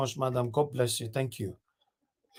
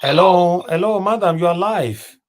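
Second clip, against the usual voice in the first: loud, higher hello; calling out; 6 semitones higher, 9 dB louder than usual. Real, not acted. Host calls a silent caller on air, loud. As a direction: call out to the line, louder.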